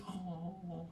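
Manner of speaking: long soft blissful oh; a breathy-free, soft, high blissful sigh of oh; blissed out oh. Retold or imitated. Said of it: "Chris's face completely goes".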